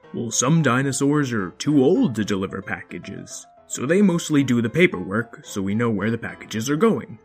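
a hint of a patient father; lightly voiced father; dad voice.

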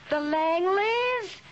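drawn-out, high-pitched thrilled reveal; thrilled exclamation. Acted. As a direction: stretch the name out high, savoring the big news. Exclaims name to puzzled husband.

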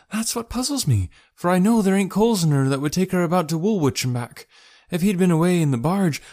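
high, voluble working woman; boatman's wife, puzzled. Acted.

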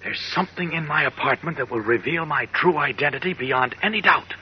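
hushed dread. Acted. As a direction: whisper the danger to a friend. Tense hushed dialogue.

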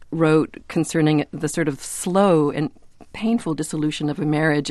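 normal talking voice

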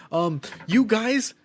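imitated Karen customer. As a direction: lightly imitate an entitled complainer, drawn out. Imitates an entitled complaining customer.

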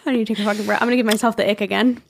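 ordinary delivery

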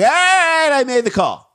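very high-pitched mock surrender; mock caving-in suspect. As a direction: squeal it as if your arm is being twisted. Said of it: "one tiny twist of the arm".

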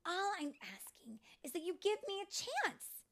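high, insistent fairy voice; insistent plea. Acted. Tink begging friends for a chance, insistent. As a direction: press them for a chance, pitch climbing. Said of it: "But Tink insisted".

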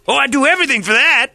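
high mock voice. Acts out [name]'s reply.

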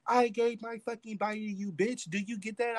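high-pitched retold tearful fury; acting out an upset, angry outburst; crying pissed. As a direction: hurl it as a wounded, high-voiced accusation. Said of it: "crying pissed".

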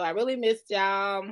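usual speaking voice

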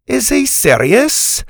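high, loud, drawn-out disbelief; incredulous. Acted. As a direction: ask the adults in exasperated disbelief. Scotty incredulous at the boy.

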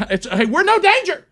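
high flustered protest. Retold or imitated. Husband flustered at the owner, high protest.

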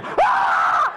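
a sudden breathy high yelp, imitating a scare; high unvoiced startle shout. Scaring the sleeping dog with a newspaper, a high breathless yelp.

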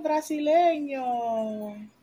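normal talking voice

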